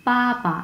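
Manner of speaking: পাপা high first syllable, short released second; fourth tone, then neutral. Shown as a demonstration. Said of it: "so এটা আমরা বলবো".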